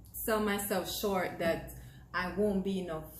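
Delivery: ordinary talk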